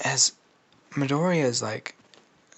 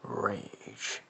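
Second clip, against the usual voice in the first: Venom; 2 semitones lower, 5 dB quieter, slower than usual. Acted. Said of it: "And Venom's like".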